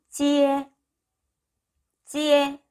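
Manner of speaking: 찌에 held high and level; teacher's sustained 찌에; model pronunciation. Shown as a demonstration. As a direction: glide 찌 into 에 on an even high note. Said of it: "라고 해야 돼요".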